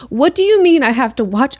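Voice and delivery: normal talking voice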